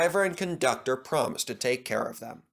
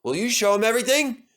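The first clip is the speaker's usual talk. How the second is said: higher, louder and brisk introduction; cheerful boss engine-master; 10 semitones higher, 5 dB louder than usual. Acted. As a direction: present the new engine quickly. Sir Topham Hatt voiced.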